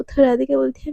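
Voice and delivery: ordinary delivery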